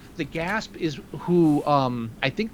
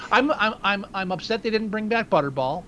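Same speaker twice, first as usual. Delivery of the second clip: ordinary delivery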